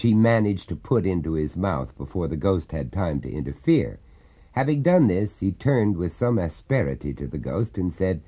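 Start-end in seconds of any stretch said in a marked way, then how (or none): none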